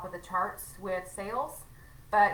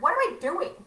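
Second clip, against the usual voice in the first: tag self-exasperation; 9 semitones higher, 7 dB louder than usual. Retold or imitated. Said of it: "and then I'm like".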